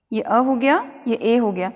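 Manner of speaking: usual speaking voice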